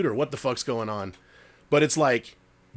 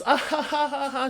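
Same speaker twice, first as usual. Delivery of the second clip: imitating the mom's cackle, pitched far up; a shrill mocking ha ha ha, much higher; high eerie cackle; 13 semitones higher than usual. Mimicking the mom laughing in the dark, high cackle. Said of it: "just laughing, laughing, laughing".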